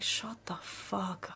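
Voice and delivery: slow, whispered exasperation; muttered retort. Acted. Host reacting to men's makeup comments, muttered under breath. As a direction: drop to a whisper, drawing it out.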